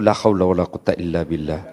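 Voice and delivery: low, soft resigned la haula; weary sigh prayer. Acted. Istighfar mengeluh soal istri. (doa pasrah rendah dan pelan)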